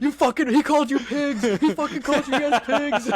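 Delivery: hammed-up angry yelling; mock furious rant. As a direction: yell it like a man losing it under arrest. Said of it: "he's like".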